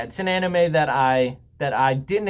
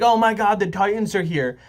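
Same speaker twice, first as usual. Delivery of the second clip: half-joking panic yell at the giants; mock panicked scream; 7 semitones higher than usual. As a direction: play a cartoonish townsperson shrieking in alarm. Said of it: "people running around yelling, screaming".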